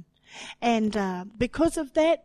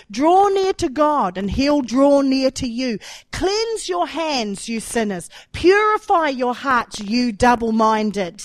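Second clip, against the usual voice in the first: loud, ringing preacher's exhortation; forceful scripture reading; 4 semitones higher, 8 dB louder than usual. Acted. Preacher reading James to believers, loud exhorting proclamation. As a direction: proclaim each command with a raised, punched opening.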